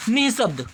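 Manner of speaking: निशब्द with a long, held "nee"; correct form repeated. Shown as a demonstration. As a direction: stretch the opening of निशब्द for learners. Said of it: "क्या होता है?".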